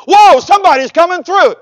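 loud, high startled exclamation; mock surprise. Preacher voicing what Jesus didn't say, mock surprise. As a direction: play astonished at an unexpected arrival.

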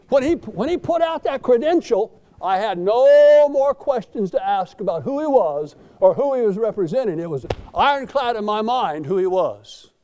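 loud, rising preacher's declaration; full-force preaching. Acted. Pastor proclaiming the credential to his church, loud preaching. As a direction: drive it home to the congregation.

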